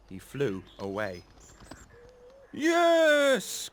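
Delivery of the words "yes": very high, loud joyful yes; joyful cry. Acted. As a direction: cheer the victory. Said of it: "cried the scarecrow".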